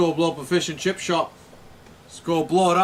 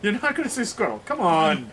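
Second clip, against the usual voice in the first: insistent, higher-pitched plea; coaxing; 5 semitones higher, 4 dB louder than usual. Real, not acted. Friend nags a refuser to say squirrel, coaxing. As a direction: coax them with exasperated insistence. Pleading with someone who keeps refusing.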